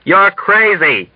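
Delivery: high, drawn-out accusation; angry shout. A narrator's reading. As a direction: shout it slowly in anger. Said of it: "shouted angrily at Dunbar".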